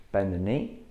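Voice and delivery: ordinary talk, nothing marked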